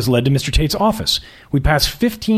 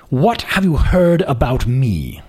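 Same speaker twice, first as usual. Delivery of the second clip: put-on slow, lofty prep-school British accent; slow aristocratic accent; slower than usual. Boss questioning the candidate slowly in an aristocratic accent. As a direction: savour each word like a bored aristocrat. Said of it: "His accent was patently aristocratic".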